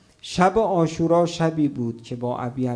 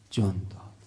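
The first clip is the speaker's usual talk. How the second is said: hushed grief; 7 semitones lower, 8 dB quieter than usual. Acted. Preacher tells of the martyr's death.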